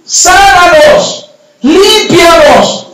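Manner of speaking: high, drawn-out plea for healing; long, high imploring shouts; crying out. Acted. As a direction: call out to someone far away. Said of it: "empiezan a gritarle a Jesús".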